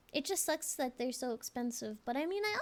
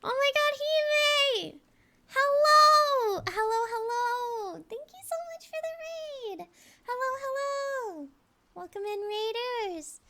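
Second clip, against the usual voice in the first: high, loud squealing welcome; sing-song cute greeting to raiders; cute anime streamer; 10 semitones higher, 8 dB louder, slower than usual. Acted.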